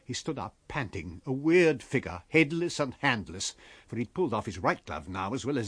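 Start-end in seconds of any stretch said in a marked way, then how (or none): none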